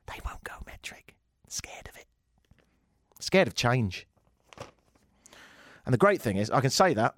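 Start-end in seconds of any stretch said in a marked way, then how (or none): 0.1-2.1 hushed, voiceless aside; conspiratorial whisper. Acted. Host confiding about Americans and metric, conspiratorial whisper. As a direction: whisper it like a secret.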